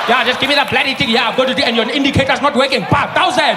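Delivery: loud, exasperated driver ranting at a traffic cop; loud fed-up rant at the cop; mock driver rant. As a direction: rant it like a fed-up motorist getting a ticket. Acted angry driver rant at cop.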